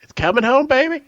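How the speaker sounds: high, gleeful celebration; excited cheer. Real, not acted. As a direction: crow it out with delight. Cheering news of a PS5 port.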